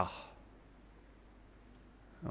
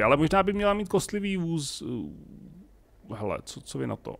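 ordinary delivery

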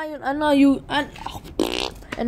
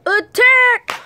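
battle cry; 10 semitones higher, 6 dB louder than usual. Pretend. Launching his special chancla.

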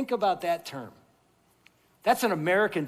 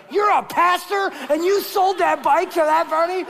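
high, loud outraged question; outraged shout; 10 semitones higher, 7 dB louder than usual. Retold or imitated. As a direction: blast it in mock outrage. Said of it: "this guy's looking at me like".

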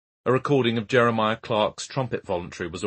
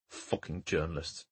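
low, slow, bitter mutter; 3 semitones lower, 9 dB quieter than usual. Acted. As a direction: grumble it under your breath. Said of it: "Hawthorne muttered".

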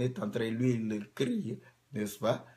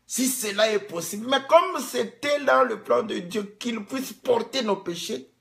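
higher, louder preaching; fiery preaching; 9 semitones higher, 5 dB louder than usual. Acted. Preacher proving his point to the congregation, raised and forceful. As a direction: proclaim the proof.

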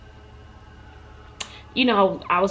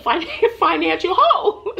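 laughing through the words, higher and louder; 9 semitones higher, 3 dB louder than usual. Real, not acted. Laughing as she tells listeners about her financial hole. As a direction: laugh it out, high.

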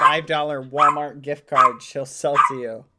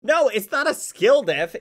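indignant; 7 semitones higher, 7 dB louder than usual. Real, not acted. Playful argument.

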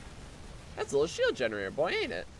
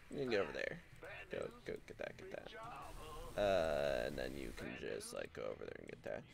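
hushed coaxing; 14 dB quieter, faster than usual. Real, not acted. Much lower, soft unvoiced coaxing units.

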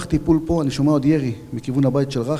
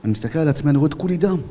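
ordinary talk, nothing marked

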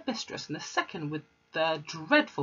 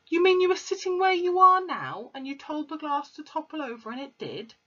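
higher teacher voice, doubtful; Miss Honey; 10 semitones higher, 4 dB louder than usual. Acted. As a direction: question the child in disbelief.

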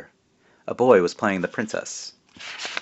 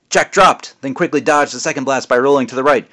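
higher and louder, pushing the action forward; excited action narration; 6 semitones higher, 5 dB louder than usual. A narrator's reading. Narrator storms through the battle scene excitedly. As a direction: read the fight like a thrilling chase. Action climax read with rising energy.

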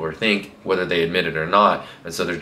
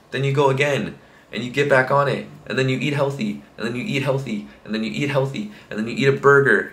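higher voice, same falling tune on each step; repetitive listing; 5 semitones higher than usual. Shown as a demonstration. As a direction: tick off each step in a repeating pattern. Rhythmic list to show repeated consistency.

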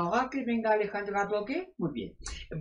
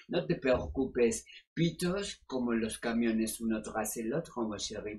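sultry therapist. Acted. Sexy doctor answering callers on air, sultry and purring. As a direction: purr it like a seductive radio doctor.

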